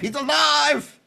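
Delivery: high, loud hammed-up Frankenstein shout; jokey triumphant shout; mock mad scientist cry. Mock Frankenstein cry about actor.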